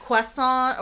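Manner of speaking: croissant as a mock-French kwah-SON; exaggerated French kwah in croissant; Frasier-style overpronouncing. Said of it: "You gotta Frasier up if you're like".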